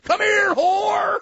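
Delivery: high loud call; rowdy yell. Retold or imitated. Farley calling a stripper over, hollering.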